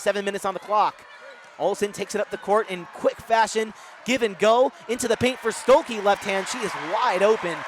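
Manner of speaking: energetic basketball commentary, rising to excited calls; live play-by-play. Real, not acted. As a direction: call the game live, surging on the big shot.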